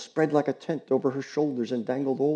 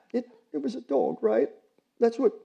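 halting, higher, near tears; breaking off; 12 semitones higher than usual. Acted. Allison struggling to speak about the dog, halting and upset. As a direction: stumble and catch yourself. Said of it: "had to break off a moment to gather herself".

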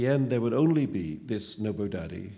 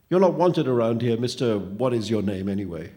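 loud, forceful telling-off; angry rejection; 8 dB louder than usual. A narrator's reading. Boy tells the phantom it is unwanted, angry. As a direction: push the intruder away with a loud voice.

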